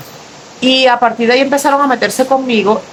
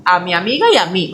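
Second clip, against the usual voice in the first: usual speaking voice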